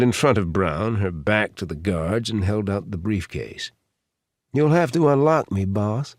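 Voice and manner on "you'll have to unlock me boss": put-on higher-pitched Southern-accented woman; Southern accent, high and twangy; southern twang. A narrator's reading. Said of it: "in a deep southern twang".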